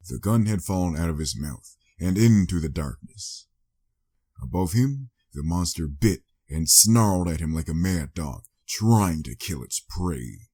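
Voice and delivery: ordinary delivery, nothing marked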